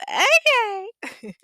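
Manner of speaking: very high, loud squealed okay; catchphrase okay. Acted. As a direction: belt out your signature catchphrase way up high. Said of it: "And when you say".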